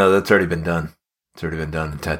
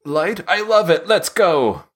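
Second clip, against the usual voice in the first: excited producer; 10 semitones higher, slower than usual. Retold or imitated. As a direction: hype up the dumb idea. Eager producer approving a rhyme.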